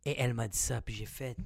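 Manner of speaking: ordinary talk, nothing marked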